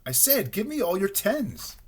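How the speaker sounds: high, slow, impatient repeat; insistent. A narrator's reading. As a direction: repeat it slowly and pointedly, pitched high. Rabbit repeats the ignored request.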